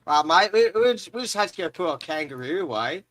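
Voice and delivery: put-on Australian accent. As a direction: ham up an Australian bloke for laughs. Joins the Aussie bit.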